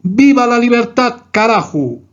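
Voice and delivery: a light imitation of a politician's slogan; Milei's exclamation. Teacher imitating Milei's slogan as an example, mock rally cry. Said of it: "Milei exclamó".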